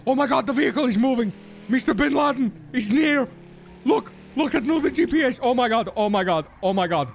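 fast, high panicked babble; scared horror game streamer. Acted. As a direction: panic as the car speeds off. Panicking as vehicle moves.

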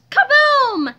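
high, booming exclamation; explosive interruption. A narrator's reading. Explosion cutting George off, bursting out loud. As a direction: burst out like a blast.

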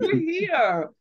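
half laughing, half crying his gratitude; imitating son, choked up. Son voiced thanking his mom, tearful and laughing. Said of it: "And he'd be like".

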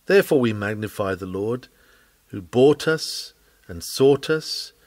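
ordinary talk, nothing marked

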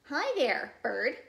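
loud, high chipper greeting; friendly rabbit. Acted. Rabbit greeting passing Bird, loud and chipper. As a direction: call out a bouncy hello.

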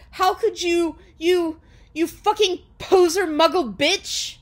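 high, loud, melodramatic outrage; shouting. A narrator's reading. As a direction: shout a hurt, halting accusation. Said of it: "he shouted".

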